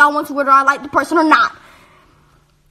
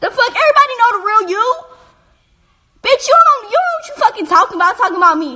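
heated outburst; 7 semitones higher, faster than usual. Real, not acted. Heated rant at the rival.

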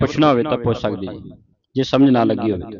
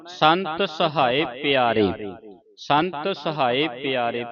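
slow, softer measured reading; unhurried, softened teaching recitation; slow model recitation; 6 dB quieter, much slower than usual. Shown as a demonstration. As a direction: stretch each word of the verse.

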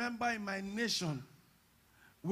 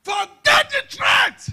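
slow, high, roaring command; preaching roar; 22 dB louder, much slower than usual. Acted. Preacher dismissing the threat with a roar. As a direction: bellow it to the congregation.

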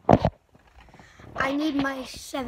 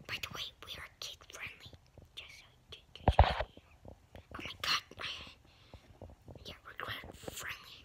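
whispered, much softer, quick note to viewers; hushed aside; 11 dB quieter, faster than usual. Real, not acted. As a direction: lean in and whisper the reminder.

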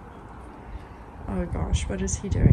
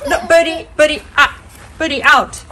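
loud firm command; calling dog out; 6 semitones higher, 8 dB louder than usual. Real, not acted. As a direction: order the dog out. Ordering dog out.

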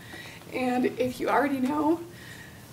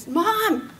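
mild mock yell, a little higher; retold yell; 5 semitones higher, 3 dB louder than usual. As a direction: call out for mom, lightly raised.

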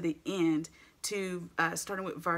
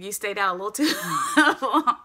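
laughing mock listener; 3 semitones higher, 10 dB louder than usual. Voicing a doubtful congregant, laughing loudly. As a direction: tease yourself through a laugh. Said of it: "you're probably thinking".

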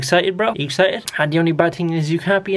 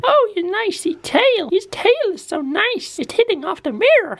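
put-on high cooing baby talk to a dog; 11 semitones higher than usual.

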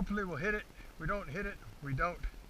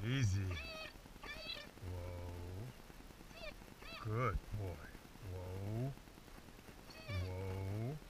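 soft, low, drawn-out whoa; calming the dog; 7 semitones lower, 21 dB quieter, much slower than usual. Real, not acted. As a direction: hush the dog down slowly. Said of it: "I just want him to calm down".

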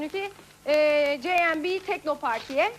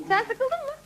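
pitched-up teasing ask; high teasing question; 9 semitones higher than usual. Real, not acted. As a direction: tease him, voice up high. Teasing the sleepy co-host.

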